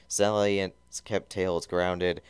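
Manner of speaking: ordinary delivery, nothing marked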